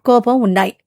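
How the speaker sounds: ordinary delivery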